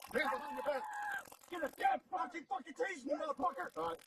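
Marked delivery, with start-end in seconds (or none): 1.5-3.7 furious yell at suspect; angry shout. Real, not acted. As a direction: yell it in real anger. Police struggle with suspect.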